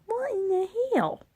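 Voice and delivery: high, soft incredulous voice; mock disbelief. Reacting in a high voice to being fired for breadsticks. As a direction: squeak out the disbelief.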